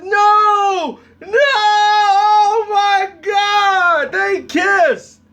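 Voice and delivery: high, loud, drawn-out wail of horror; hammed-up scandalized audience outcry; shocked mock shouting. Movie crowd reacting to a taboo kiss, shocked shouting.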